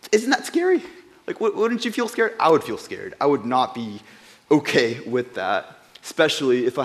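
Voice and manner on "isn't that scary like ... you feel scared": rising excitement. Real, not acted. Rhetorical question to audience.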